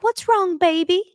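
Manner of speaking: tender mom. Retold or imitated. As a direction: ask gently like a mother. She was like.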